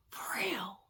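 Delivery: soft, whispered mock surprise; toned-down surprise. Told to tone it down, whispered fake surprise. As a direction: take it down two notches. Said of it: "tone it down a little bit".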